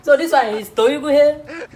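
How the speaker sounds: Toyuguhe drawn out slowly, each letter sounded; comic teacher reading tough as Toyuguhe; mock phonics. Shown as a demonstration. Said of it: "It's pronounced as Toyuguhe".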